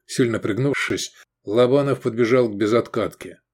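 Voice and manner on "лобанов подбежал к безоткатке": ordinary delivery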